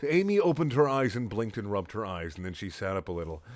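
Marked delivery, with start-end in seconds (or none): none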